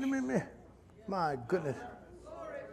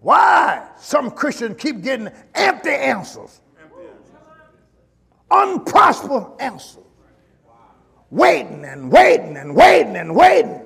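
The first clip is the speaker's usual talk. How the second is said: preaching at full force; 5 dB louder than usual. Acted. Preacher urging the church, at full force. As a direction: drive the exhortation home like a pulpit call.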